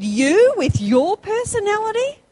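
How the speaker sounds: high, scoffing question; scornful friends doubting Joyce Meyer; mocking friends. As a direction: sneer it in disbelief. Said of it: "They said".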